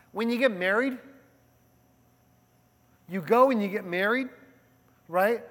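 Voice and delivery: ordinary talk, nothing marked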